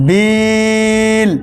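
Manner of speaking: "বিল" with a very long, steady ই; slow, sustained "বিল"; three-alif elongation. Shown as a demonstration. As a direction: hold the vowel of "বিল" on one note before the final ল. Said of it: "এটা তিন আলিফ পরিমাণ হয়ে যাবে".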